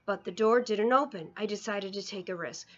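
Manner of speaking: ordinary delivery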